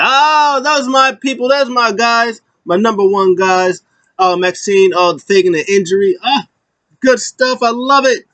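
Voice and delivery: high, effusive imitation; mock gushing praise. Manager praising his guys backstage, gushing.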